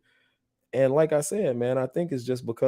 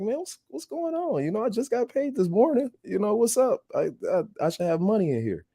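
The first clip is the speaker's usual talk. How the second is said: baffled plea; 12 semitones higher than usual. Retold or imitated. To the parking garage machine, baffled and high-pitched. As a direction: act confused, like your card just got declined. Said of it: "And I'm like".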